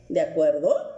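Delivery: normal talking voice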